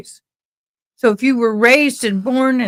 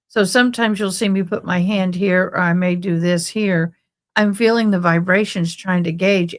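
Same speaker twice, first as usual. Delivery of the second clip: ordinary delivery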